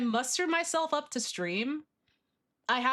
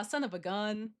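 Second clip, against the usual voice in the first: normal talking voice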